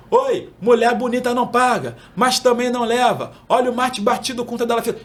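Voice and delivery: lightly voiced seller calling flavours; beach vendor call. Shown as a demonstration. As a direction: rattle off flavours to passing customers. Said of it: "vai divulgando o teu produto".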